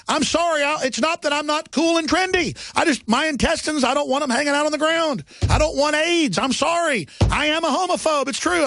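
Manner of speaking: high-pitched sarcastic apology; sarcastic rant, pitched high throughout. Acted. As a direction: protest in mock confession, voice pushed up. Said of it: "I'm being sarcastic about that".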